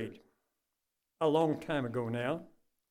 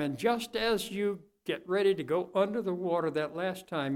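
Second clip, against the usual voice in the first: ordinary talk, nothing marked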